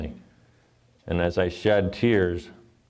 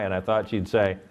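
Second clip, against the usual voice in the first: ordinary talk, nothing marked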